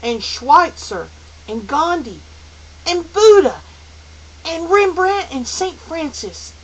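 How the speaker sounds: put-on loud, high, assured boy; ringing confidence. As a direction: ring out each name proudly. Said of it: "Calvin's voice rang with confidence".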